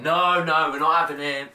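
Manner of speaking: security guard refusing. Retold or imitated. Security refusing Megatron his gun, loud mock refusal. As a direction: play the guard blocking him out. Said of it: "the security said".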